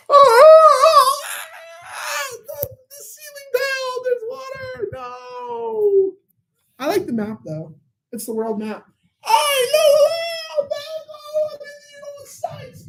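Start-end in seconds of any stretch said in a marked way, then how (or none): 0.1-6.1 very high, wailing hammed-up panic; mock news anchor panic. Mocking how news should sign off, with hammed-up panicked wailing. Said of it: "I wish they'd do that on the news". 9.2-12.8 goofy high voice. Acted. Goofy high voice about map.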